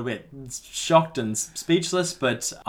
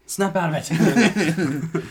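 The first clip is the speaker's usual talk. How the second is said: higher, drawn-out mock urging; mock urgent plea; 6 semitones higher, 4 dB louder, much slower than usual. Player rousing the babbling doctor, mock urgent plea. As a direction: plead slowly, hammed up, close to laughing. Acted plea after the slap.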